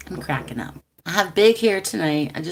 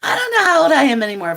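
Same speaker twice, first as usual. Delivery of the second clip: higher, louder, fast laughing outburst; excited exclaiming; 9 semitones higher, 7 dB louder, much faster than usual. Real, not acted. She laughs at losing track of her age, exclaiming. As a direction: throw it out in amused disbelief. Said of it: "laughing at her own age".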